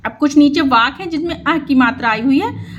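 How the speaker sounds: usual speaking voice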